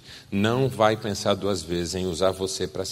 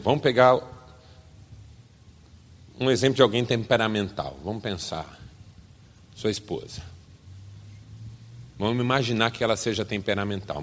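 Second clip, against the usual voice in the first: usual speaking voice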